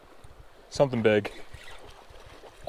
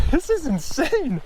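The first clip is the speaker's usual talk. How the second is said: much higher, slower, drawn out in awe; awestruck high; 13 semitones higher, slower than usual. Real, not acted. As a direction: stretch "This is insane" up high in wonder.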